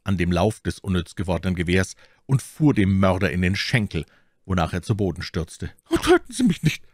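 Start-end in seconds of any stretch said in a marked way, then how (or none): 5.9-6.8 very high pleading bandit voice; panicked begging, pitched far up; terrified cry. Acted. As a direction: cry out for your life in a thin high pitch. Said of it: "schrie der Bandit".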